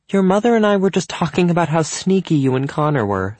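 high, lifted, sociable voice; teasing hostess. A narrator's reading. Cynthia tapping Evan's knee about being sneaky, teasing. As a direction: tease them lightly.

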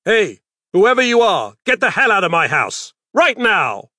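loud, high stern yell; stern shout. Acted. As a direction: shout him out of the house. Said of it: "spoke in his sternest voice".

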